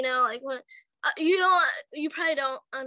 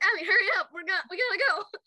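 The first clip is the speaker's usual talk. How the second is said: urgent plea; 6 semitones higher than usual. Retold or imitated. Urging friend stuck in traffic.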